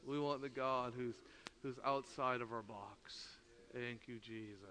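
low, soft, prayerful; quiet reverence. Real, not acted. Preacher turning from story to thanking Jesus, quiet reverence. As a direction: sink into a hushed thanks.

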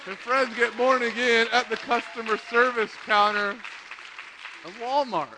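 loud, high, marvelling repeat; emphatic amazement. Real, not acted. Preacher marvelling at salvations in Walmart, emphatic amazement. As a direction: belt it out as astonishing news.

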